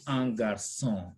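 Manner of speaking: "un garçon" with the ç said as S; an S, never a K, in "un garçon"; soft C as S. Shown as a demonstration. Teacher explains the accent under C, "un garçon" with S sound. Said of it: "to have that S sound".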